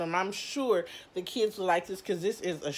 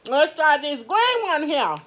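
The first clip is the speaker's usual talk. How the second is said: high and loud, eager; bright eager announcing; excited; 8 semitones higher, 8 dB louder than usual. Real, not acted. Excited to try next donut.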